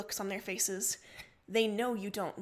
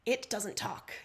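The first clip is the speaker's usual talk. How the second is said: usual speaking voice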